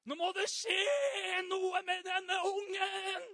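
loud, high, slow desperate cry; hammed-up desperate parent yelling at God. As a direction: wail it like a parent at wits' end. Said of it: "om du nå kjenner at du blir desperat".

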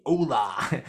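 "Hola." said in a broad Australian accent, half laughing; Aussie-accented Spanish. Shown as a demonstration. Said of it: "I say that like a real, real Aussie".

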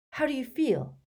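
high, worried question; anxious. A narrator's reading. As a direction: check on him anxiously. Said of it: "he asked anxiously".

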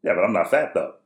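matter-of-fact retort, imitating a son; unfazed kid. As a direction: say it without batting an eye. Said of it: "without batting an eye".

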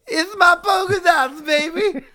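high, slow strained wrestler yell; comic wrestler cry; hammed-up catchphrase. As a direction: belt it like a cartoonish heel.

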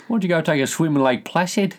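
high-pitched teasing Aussie invitation; joking Australian. Pretend. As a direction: play a joker egging someone on. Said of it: "That sounds like a very Australian thing".

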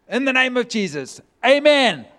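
half-embarrassed shouted amen to end it; booming amen. Retold or imitated. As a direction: slam the prayer shut with a loud amen. Awkwardly ending prayer over wailing waiter.

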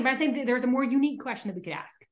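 ordinary delivery

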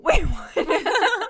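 high, laughing wait what; laughing disbelief. Real, not acted. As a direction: crack up in disbelief. Reacting to absurd story line.

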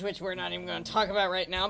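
normal talking voice

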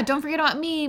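high mocking echo; mocking whiny voice. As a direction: mimic the needy poster. Echoing the mocking quote.

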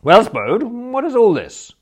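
loud, high, mock-stern; high, loud reproach; lofty challenge. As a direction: take the upper hand with relish. Bertie confronting cowed Spode.

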